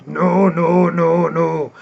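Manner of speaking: rumbly African-accented shot-blocker impression; Dikembe Mutombo. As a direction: scold a challenger in an imitated African accent. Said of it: "an African accent of Dikembe Mutombo".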